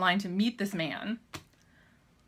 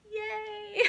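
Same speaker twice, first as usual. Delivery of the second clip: tiny high-pitched yay; retold little cheer; 13 semitones higher than usual. Fan answering the handler's welcome, small high squeal.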